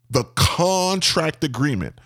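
"contract agreement" with CON stretched out; over-the-top emphasis. Shown as a demonstration. Owner producing the document, grand stress on "contract agreement". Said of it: "put emphasis on the word contract agreement".

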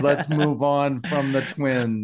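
slow, stretched-out exclamation; drawn-out mock creeped-out. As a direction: drag out each word in mock unease. Retells own creeped-out reaction to twins.

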